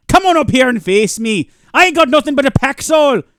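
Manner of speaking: loud high bad Irish-Scottish brogue; bad Shrek Jacksepticeye voice. Gamer taunting the alien robot in a bad Irish brogue. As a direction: roar a hammed-up brogue challenge. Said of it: "bad Shrek slash Jacksepticeye impersonation".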